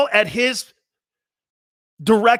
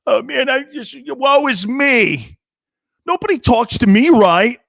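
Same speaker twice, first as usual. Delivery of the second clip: higher, drawn-out mock whine that swells louder; whiny moaner; 5 semitones higher, 4 dB louder than usual. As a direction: mimic a sulking star player crying woe is me. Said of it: "crying and moaning the entire offseason".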